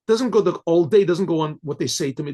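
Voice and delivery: ordinary talk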